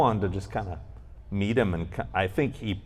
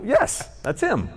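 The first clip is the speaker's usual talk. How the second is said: exasperated confirmation; 12 semitones higher, slower than usual. Retold or imitated. Retells vindicated reply to doorman.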